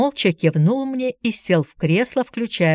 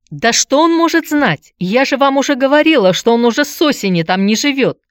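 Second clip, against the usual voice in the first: high, loud indignant outburst; exasperated shout; 7 semitones higher, 6 dB louder than usual. A narrator's reading. Aunt cuts off visitor questioning her nephew, shouting. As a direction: lose patience and cry out in protest. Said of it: "не выдержав, закричала тётка".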